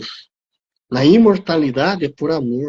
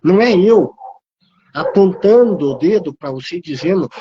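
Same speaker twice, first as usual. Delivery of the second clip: usual speaking voice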